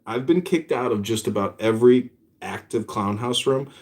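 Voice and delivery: ordinary delivery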